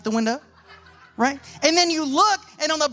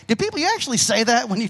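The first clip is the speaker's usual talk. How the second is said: ordinary talk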